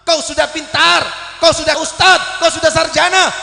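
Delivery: loud scolding preacher; stern rebuke. Acted. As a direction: scold them for shaming their parents. Rebuking the congregation. (teguran keras kepada jamaah)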